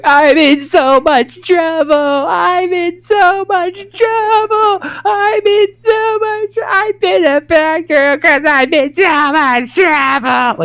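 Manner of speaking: high, slow, wailing sob voice; imitating sobbing child. Dad mimics daughter's sobbing apology, high and slow. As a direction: wail it like a crying little girl. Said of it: "complete full sobbing".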